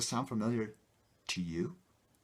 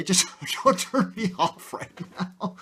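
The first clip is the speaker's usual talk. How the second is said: laughing; 9 semitones higher, 7 dB louder than usual. Real, not acted. Pleading viewer with a laugh.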